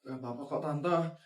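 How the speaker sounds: put-on soft, sad child voice; shy kid speaking barely above a murmur; timid bullied child.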